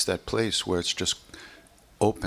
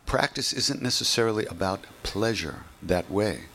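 normal talking voice

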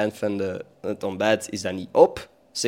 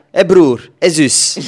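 louder, higher mock greeting; ouder roept kinderen; 6 semitones higher, 11 dB louder, much slower than usual. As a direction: hail the kids as brother and sister. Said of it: "hun zoon en dochter zeggen". (luid en hoog de kinderen roepen)

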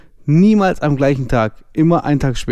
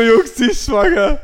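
high-pitched, stunned blurting; retold frantic announcement; 11 semitones higher, 3 dB louder than usual. Boyfriend tells his friends she's pregnant, retold frantic blurt. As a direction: burst out with the news to the group.

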